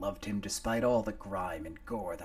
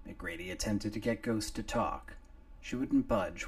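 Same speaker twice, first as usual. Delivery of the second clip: plain reading voice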